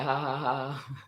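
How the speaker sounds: mimicked "ha, ha, ha" laugh; retold laugh at the country club; mock laughter. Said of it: "laughing at the country club".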